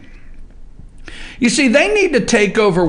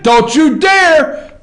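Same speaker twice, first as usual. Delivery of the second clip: mock warning; 6 semitones higher, 3 dB louder, slower than usual. Real, not acted. Warning the booth.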